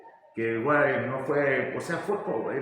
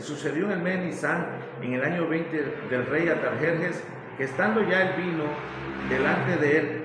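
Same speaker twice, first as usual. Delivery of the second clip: scripture reading; 3 semitones higher than usual. Acted. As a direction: read the passage out as in church. Reads Nehemiah aloud. (lectura bíblica en voz alta y elevada)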